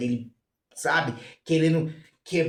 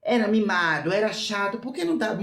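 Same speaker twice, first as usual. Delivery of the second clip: ordinary delivery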